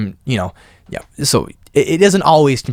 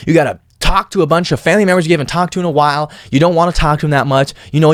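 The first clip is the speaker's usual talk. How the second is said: ordinary talk, nothing marked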